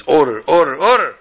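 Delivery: loud, rising gavel-banging call; judge calling order. Acted. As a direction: bellow over the uproar.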